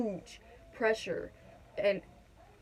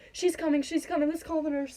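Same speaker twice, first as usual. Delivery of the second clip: higher, louder urgent cry; urgent alarm; 6 semitones higher, 4 dB louder than usual. Retold or imitated. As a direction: sound the alarm that the baby is coming. Baby crowning, calling for help.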